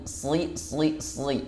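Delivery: soft sli with heavy shad; thick shad. Shown as a demonstration. Teacher drilling shad in sli. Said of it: "sli bukan si".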